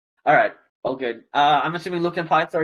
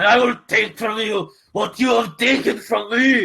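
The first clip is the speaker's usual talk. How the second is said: higher, louder Irish vow; Irish accent demo; 5 semitones higher, 3 dB louder than usual. Shown as a demonstration. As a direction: deliver the threat in a thick Irish accent. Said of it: "and I'll do it for you".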